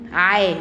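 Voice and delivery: "ع" loudly as ayn plus a; ayn with fatha. Shown as a demonstration. Teacher prompts pupils with the fatha sound, "ع" loud with a. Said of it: "أنا قلت إيه".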